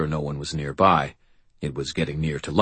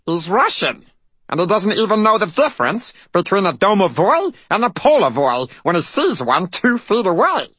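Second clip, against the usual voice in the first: high, loud indignant fairy voice; tiny fairy sputtering with outrage; outraged fairy; 5 dB louder than usual. A narrator's reading. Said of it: "The fairy quivered with outrage".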